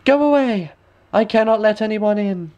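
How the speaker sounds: put-on high, light girlish voice; a wary young princess refusing; high girlish refusal. A narrator's reading.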